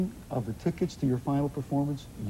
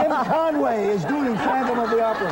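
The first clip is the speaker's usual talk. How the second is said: grand stage announcement, higher; announcer voice; 7 semitones higher than usual. Pretend. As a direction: announce it like a theatre host. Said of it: "the manager who gets to announce".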